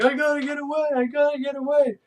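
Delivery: high, frantic mock panic; hammed-up terrified runaway; panicked escapee. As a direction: squeal it like a fleeing patient. Panicked patient running away.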